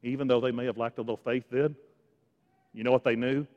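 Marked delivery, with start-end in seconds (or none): none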